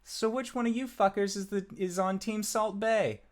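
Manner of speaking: high, fast sweet granny voice; a sweet-faced grandma with a foul mouth; old halfling woman voice. Acted. Halfling grandmother greeting the party, high sweet voice. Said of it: "with this very calm, gentle smile".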